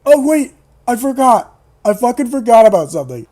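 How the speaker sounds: loud, high burst of remembering; sudden realization. Real, not acted. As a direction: cut in urgently as it hits you. Suddenly remembers a plot point.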